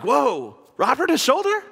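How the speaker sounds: hammed-up disbelief; mock surprise. Preacher joking God is shocked by prayer, mock surprise. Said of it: "he's not like".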